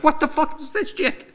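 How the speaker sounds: high panicked squeal; panicked freakout. Retold or imitated. Seeing blood in his ear, a high panicked squeal.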